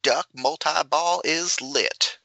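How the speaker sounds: high, slow southern announcer impression; imagined southern callout. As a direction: stretch it out like a southern game voice. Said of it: "I can picture him saying".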